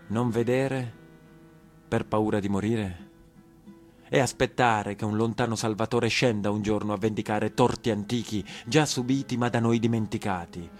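usual speaking voice

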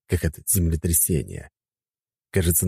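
plain reading voice

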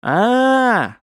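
long high aah of understanding; old man's prolonged high "aah"; drawn-out "aah". A narrator's reading. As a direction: stretch out a high "ah". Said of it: "вслед протянул дед".

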